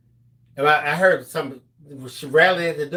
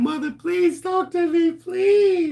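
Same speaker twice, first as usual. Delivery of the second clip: high, begging please; pleading, near tears; 13 semitones higher, slower than usual. Retold or imitated. Son begging his silent mother to talk. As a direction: plead like a crying child. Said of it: "I was practically crying".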